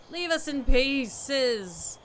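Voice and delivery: slow, loud drawn-out yell. Retold or imitated. Villager yelling the outsider away, slow loud yell. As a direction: stretch it out as a hostile shout. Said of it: "she's yelling at me from across".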